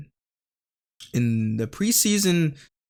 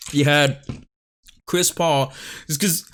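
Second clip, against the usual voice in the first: chewing ice while talking; ice in mouth; 2 semitones higher than usual. Real, not acted. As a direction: talk around a mouthful of ice. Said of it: "sorry, I'm chewing ice".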